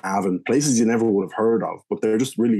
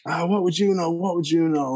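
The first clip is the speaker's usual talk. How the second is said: giving it back; 9 semitones higher than usual. Retold or imitated. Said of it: "I was giving it back to him".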